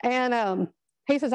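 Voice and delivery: ordinary delivery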